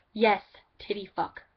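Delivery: mock friend saying it out loud; voiced take. Shown as a demonstration. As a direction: say it out loud in the put-on voice. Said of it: "I said that with a little bar".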